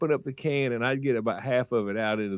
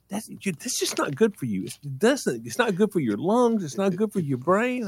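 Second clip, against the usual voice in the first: higher-pitched mock maternal lecture; mother nagging; 9 semitones higher than usual. Mama lecturing her child about gas fumes, nagging. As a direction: nag like a worried mom.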